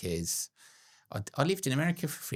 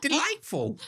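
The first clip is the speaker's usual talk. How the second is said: mock posh voice; 6 dB louder than usual. Mock posh reply to Sam.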